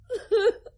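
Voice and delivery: high, loud broken laugh-cry; loud high sob-laugh; grieving outburst. Acted. Grieving after hearing of drowning.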